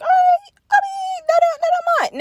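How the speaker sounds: giddy squeal, pitch way up; high squeaky voice. Retold or imitated. Her own excited quote in the story.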